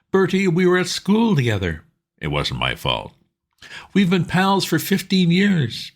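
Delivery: pleading pal, keen and urgent; eager Bingo. A narrator's reading. Bingo asking his old friend Bertie for help, eager and high.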